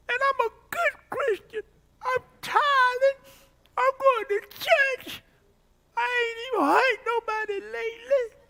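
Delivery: high, loud, whining plea; whiny complaint to God. Retold or imitated. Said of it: "That's the way I was sounding".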